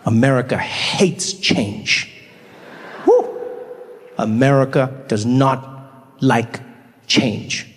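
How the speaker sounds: slow, low mock-solemn pronouncement. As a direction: intone it like a grave truth.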